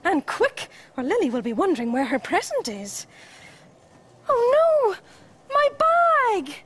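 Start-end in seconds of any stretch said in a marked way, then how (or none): 4.3-6.5 high, loud dismayed cry; sudden panic, pitched well up; alarmed. Acted. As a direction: cry out on realising a loss. Realises bag was left behind.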